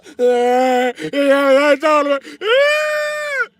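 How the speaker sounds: a shrieking high cackle of laughter. Real, not acted. Comic cracking up at his own bit, shrieking cackle.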